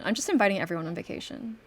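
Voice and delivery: normal talking voice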